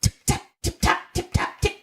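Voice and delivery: whispered high tip-tap; high voiceless mouse tapping; tapping noises. Acted. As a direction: tap it out like tiny feet.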